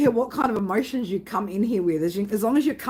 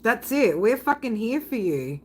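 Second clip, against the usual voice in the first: normal talking voice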